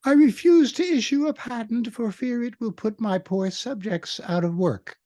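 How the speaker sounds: high, put-on posh British accent as Queen Elizabeth; fake British accent. Quizzing the audience, in a hammed-up fake British accent. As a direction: ham up a royal British accent, pitched high. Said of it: "fake British accent to give you a hint".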